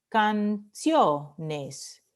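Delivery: "Canciones" split into can, CIO, nes; syllable by syllable. Shown as a demonstration. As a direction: sound out "Canciones" in three beats, stressing CIO. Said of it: "penúltima sílaba".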